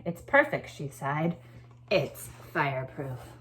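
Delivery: lively read-aloud, lifting the key words; storytelling voice. A narrator's reading. Teacher reading a story to her class, lively storytelling voice. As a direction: read it like a picture book to a class.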